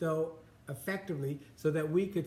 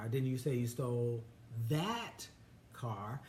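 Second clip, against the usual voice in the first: "that" set apart by pauses and pitched up; pause, raised "that", pause, low soft ending; stress on THAT; 4 semitones lower, 3 dB quieter than usual. Shown as a demonstration. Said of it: "Emphasis on different words using the same sentence".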